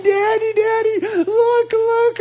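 put-on a four-year-old bursting with excitement, near tears of joy; father imitating his daughter showing off her princess dress; thrilled little daughter. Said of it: "She was so excited for me to look at her".

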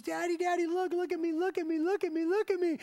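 put-on excited little girl. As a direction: imitate a small girl begging to be seen. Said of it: "She goes".

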